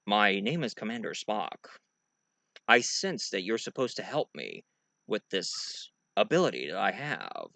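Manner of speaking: soft, low, measured introduction; subdued deadpan officer; logical science officer. Acted. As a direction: state it quietly, without emotion. Spock introduces himself to the master.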